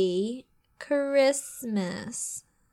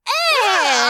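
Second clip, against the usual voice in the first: louder choked yelp, drawn out; strangled cry; 10 semitones higher, 5 dB louder than usual. Shown as a demonstration. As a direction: let out a strangled cry on request. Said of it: "with a strangled cry".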